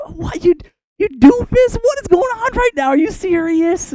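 very high, hammed-up exasperation; comic squealed scolding; outraged disbelief. Moses scolding Aaron over his excuse, hammed-up outraged disbelief.

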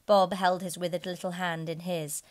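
ordinary delivery, nothing marked

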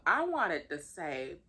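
normal talking voice

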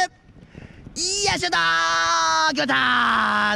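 long drawn-out rising goal shout; excited goal call. Real, not acted. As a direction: shout the goal, stretching every vowel. Goal scored in live commentary. (興奮したゴール実況)

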